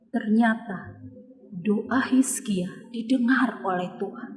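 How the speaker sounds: whispered, secret-sharing tone; hushed reveal. A narrator's reading. Storyteller tells children the prayer was heard, whispering. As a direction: lean in and whisper the good news.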